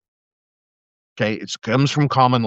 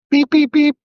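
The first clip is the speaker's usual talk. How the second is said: high, loud imitated beep tones; radio alert beeps; 6 dB louder than usual. Cop imitating the dispatch alert on his radio, high loud beeps. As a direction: mimic the dispatch radio beeping.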